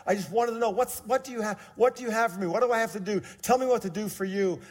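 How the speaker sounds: begging for an answer, urgent; frustrated pleading. Retold or imitated. Young man pleading with God in prayer, urgent.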